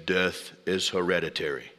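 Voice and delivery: put-on deep, low Bavarian-accented voice. Preacher imitating Werner Herzog in a deep Bavarian accent. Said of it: "in a deep Bavarian accent says".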